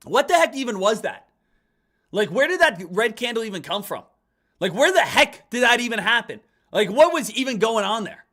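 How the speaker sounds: loud, high, disbelieving rant; what on earth just happened, said loud; baffled outburst. Real, not acted. Trader baffled by a sudden red candle, loud disbelief.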